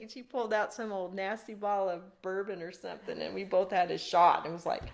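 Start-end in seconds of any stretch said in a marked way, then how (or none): none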